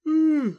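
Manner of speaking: long high yawn; a loud yawn through a closed mouth. Real, not acted. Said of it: "Sorry, just yawning".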